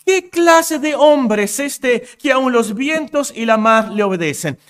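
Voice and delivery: astonished disciples. Retold or imitated. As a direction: gasp it out like men in a storm. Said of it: "Y ellos dicen".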